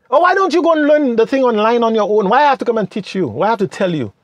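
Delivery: high, indignant brush-off imitating a selfish friend; mock stingy friend. Acting out the friend who won't share, high mock indignation.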